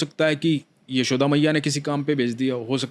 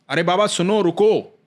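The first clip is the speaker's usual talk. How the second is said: put-on higher, louder call to the old man; boy calling out; 5 semitones higher, 4 dB louder than usual. Cowherd boy calling after the devotee to stop, loud. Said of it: "एक बालक आवाज दे रहा है".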